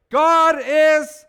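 ordinary delivery

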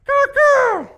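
very high, loud raven squawk; raven caw. Shown as a demonstration. Preacher demonstrates what exclaimed means, raven caw. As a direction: screech it out like a raven. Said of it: "I don't know how a raven caws".

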